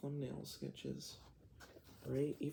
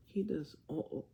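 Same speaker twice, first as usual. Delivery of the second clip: ordinary talk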